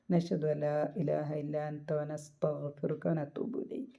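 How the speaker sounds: slow, soft Arabic supplication; closing prayer. Acted. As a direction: recite the closing dua slowly and devoutly.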